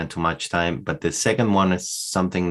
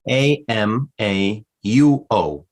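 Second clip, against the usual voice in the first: ordinary talk, nothing marked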